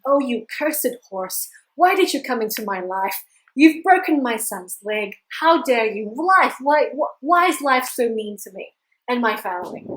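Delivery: loud, acted outrage at fate; anguished cursing. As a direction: rail loudly against a cruel life. Old man curses horse and life.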